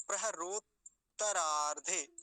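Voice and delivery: प्रहरोत्तरार्धे slowly, pausing after प्रहरोत्; प्रहरोत्तरार्धे in two parts, both त heard; broken-up pronunciation. Shown as a demonstration. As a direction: stop lightly on त् in प्रहरोत्तरार्धे, then तरार्धे. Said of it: "पहले यहाँ रुक जाएंगे हल्का सा".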